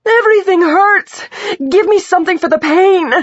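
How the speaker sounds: high, loud, pleading scream; screaming in pain. A narrator's reading. Injured patient begging for pain relief, screaming. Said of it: "she screamed out".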